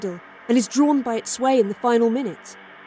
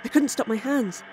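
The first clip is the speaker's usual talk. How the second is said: ordinary delivery, nothing marked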